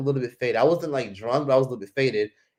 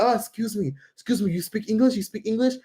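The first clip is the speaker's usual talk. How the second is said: higher-pitched imitation of a man approaching; eager young stranger; 8 semitones higher than usual. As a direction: mimic a stranger trying to get attention. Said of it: "He's like".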